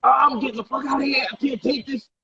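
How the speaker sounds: high, heated yell of a furious woman; storming off, mocking her fury; angry outburst. Girlfriend raging at him in the car, high angry yell. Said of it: "she got so angry".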